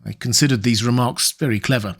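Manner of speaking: ordinary delivery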